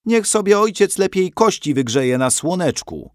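high-pitched huffy retort; indignant. A narrator's reading. Mother tells grandfather to go sun his bones, indignant. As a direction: brush the old man off indignantly. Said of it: "obruszyła się moja matka".

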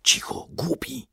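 sharp whispered hush; scolding whisper; hissed. A narrator's reading. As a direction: hiss it under your breath. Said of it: "syknął Stasiek".

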